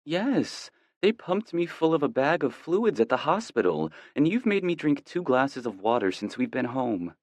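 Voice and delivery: put-on a girlfriend whining playfully. A narrator's reading. Girlfriend voiced in bed.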